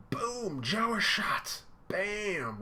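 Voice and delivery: high, excited impact calls; hype sound effects. Real, not acted. Reading the fight to viewers, excited high impact calls. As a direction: call the hits like a fan.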